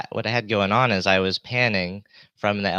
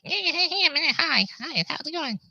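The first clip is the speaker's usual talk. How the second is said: much higher, thin squeaky greeting. Shown as a demonstration. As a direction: chirp it up high and bright. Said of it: "so I'm, like, not like".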